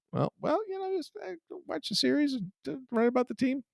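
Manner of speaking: mock-nervous squeak of a rookie reporter; timid newcomer. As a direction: shrink and stammer like an outsider caught out. Young reporter answers writers timidly.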